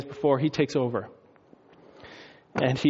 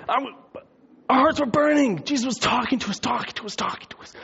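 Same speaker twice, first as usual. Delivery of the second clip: high start, fading into breathy repeats; breathless excitement; 9 semitones higher than usual. Acted. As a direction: gabble it as an amazed disciple, trailing away. Disciples excited after recognizing Jesus.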